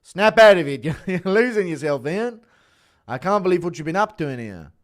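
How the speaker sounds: high, loud scolding shout at a lost friend; Vulcan JDM impression. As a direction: snap him out of it, way up and loud. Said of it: "Impression of Vulcan J D M".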